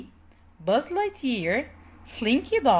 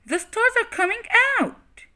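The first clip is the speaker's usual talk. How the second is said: high wondering voice; high awed voice; amazed; 13 semitones higher than usual. Acted. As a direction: marvel at the sky. Buttercup marvels at stars.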